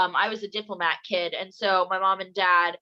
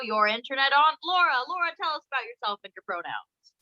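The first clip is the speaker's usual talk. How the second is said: show host intro; 4 semitones higher than usual. Acted. Hosts a mock show opening.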